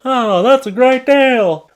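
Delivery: high, loud, drawn-out fake delight; mock excitement. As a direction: gush slowly over the fake bargain. Sarcastic reaction to non-bargain.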